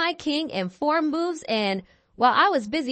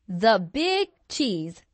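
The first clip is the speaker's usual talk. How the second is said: slow, stretched "the big cheese" with big leaning hard; drawn-out proud title; 4 semitones lower, slower than usual. Acted. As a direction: savour every word of "the big cheese" like a grand title. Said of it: "say it with me".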